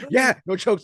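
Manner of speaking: high loud teasing repeat; mock yell. Coach teasing the ref, high loud mock shout. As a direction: throw it back at the ref half laughing. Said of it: "I'm like".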